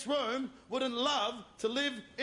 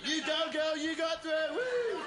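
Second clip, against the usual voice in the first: cheering audience; 6 semitones higher, slower than usual. Retold or imitated. Comic mocking the crowd, hammed-up cheering. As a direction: whoop it up like a fan. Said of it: "you're all on her side".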